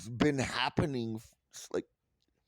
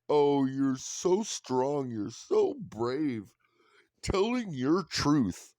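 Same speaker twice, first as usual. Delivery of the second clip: slow, gushing fake admiration; mock praise; 5 semitones higher, slower than usual. Mocking the world cooing over the princess. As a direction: simper it out like a fawning fan. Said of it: "watches this type of shit and goes".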